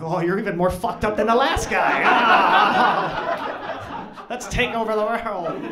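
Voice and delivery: high, loud, hammed-up devilish glee; excited Devil. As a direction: shout it in mock wicked delight.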